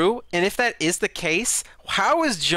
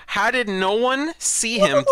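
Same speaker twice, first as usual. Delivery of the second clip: slow, higher-pitched incredulous question; exasperated disbelief; 6 semitones higher, slower than usual. Real, not acted. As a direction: drag it out in disbelief. Baffled by the unnoticed escape.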